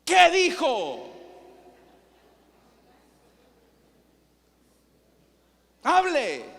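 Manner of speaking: loud, high, drawn-out bellowed demand; scolding shout. Shown as a demonstration. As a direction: shout it as a harsh telling-off. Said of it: "Solo regañando".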